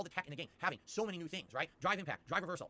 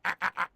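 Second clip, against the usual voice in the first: loud barking ack sounds; loud ack honks; 9 dB louder, slower than usual. Shown as a demonstration. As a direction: honk it out for the clip. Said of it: "your stupid audio clip".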